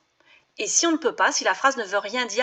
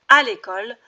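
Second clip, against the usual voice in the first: "à" hit louder, "à l'école"; stress on à; 5 dB louder than usual. Shown as a demonstration. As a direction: punch the "à" in "à l'école". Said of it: "A avec un accent".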